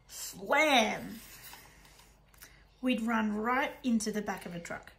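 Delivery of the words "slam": crash. Acted. Crash sound effect.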